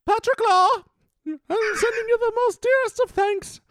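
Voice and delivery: very high falsetto royal voice; hammed-up monarch in a piping falsetto; Queen Elizabeth. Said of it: "let's get some Queen Elizabeth".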